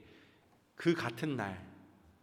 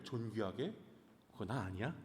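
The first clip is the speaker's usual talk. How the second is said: private thought; 6 semitones lower, 12 dB quieter than usual. Acted. Haman's private smug thought.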